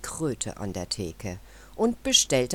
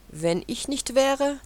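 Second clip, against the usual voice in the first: proud rooster; 11 semitones higher, 4 dB louder than usual. A narrator's reading. Rooster boasting to the club.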